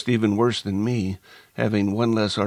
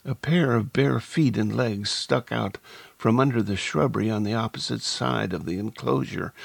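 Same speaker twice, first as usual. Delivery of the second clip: plain reading voice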